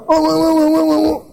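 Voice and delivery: high wordless "oh-oh-oh" rant. Acted. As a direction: imitate someone raising their voice in a high babble. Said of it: "Sebagian orang kalau berbicara angkat suara".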